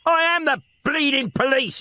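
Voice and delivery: high exasperated yell. Acted. Detective fending off old woman, yelling in exasperation. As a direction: bellow it back at her. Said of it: "he yelled".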